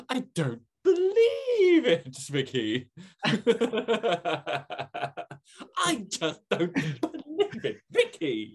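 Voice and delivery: very high, slow, laughing disbelief; Stephen impression. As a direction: ham up the shocked companion. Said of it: "my Stephen impression".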